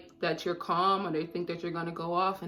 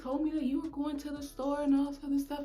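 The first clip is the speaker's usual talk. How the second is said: higher-pitched, mildly huffy complaint; imitating the upset lady; 6 semitones higher than usual. Lady scolds the brother for not mentioning the store, higher and huffy. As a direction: whine that her money was wasted.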